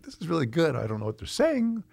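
high, soft aside to oneself; bemused inner voice. Retold or imitated. Retold thoughts while watching the film.

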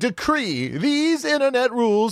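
high, slow, grand decree; drawn-out, high-pitched mock edict; mock proclamation. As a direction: proclaim it like a pompous ruler. Mock royal proclamation of rules.

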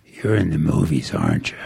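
put-on low gruff old man; rough, low voice of an old stranger. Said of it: "in this real gruff voice".

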